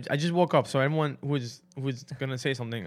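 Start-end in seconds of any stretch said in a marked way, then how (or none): none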